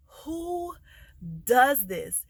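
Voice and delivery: high, drawn-out "who", then a punch; appalled disbelief. Retold or imitated. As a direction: stretch it out in utter disbelief. Appalled reaction to the signing.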